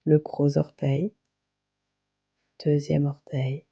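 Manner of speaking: calm, unhurried yoga nidra guidance with long pauses; guided meditation. Acted. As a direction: guide them gently into relaxation, phrase by phrase. (voix posée de méditation guidée, avec de longues pauses)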